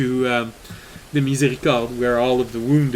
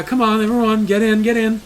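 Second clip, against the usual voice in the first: higher, louder hurrying call; urging priest; 7 semitones higher, 3 dB louder than usual. Acted. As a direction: wave the crowd inside. GM voices priest waving people in.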